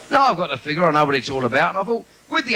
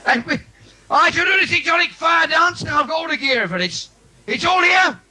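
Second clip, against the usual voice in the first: higher and louder, a grand music-hall build-up; showman announcement; 12 semitones higher, 4 dB louder than usual. Acted. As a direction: announce it like a circus ringmaster. Announcing his fire dance.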